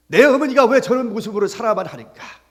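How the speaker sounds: lightly acted, loud lament about mother; daughter's complaint. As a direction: cry out the complaint as a preacher would.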